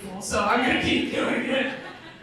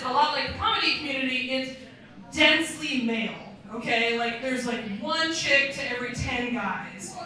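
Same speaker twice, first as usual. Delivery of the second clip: ordinary talk, nothing marked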